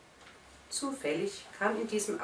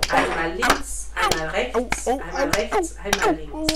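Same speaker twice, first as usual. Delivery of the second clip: loud, each slap rising higher in pitch; loud rhythmic slaps; 7 semitones higher, 10 dB louder than usual. A narrator's reading. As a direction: call out each blow like a ringing smack. Slaps from the clock pendulum.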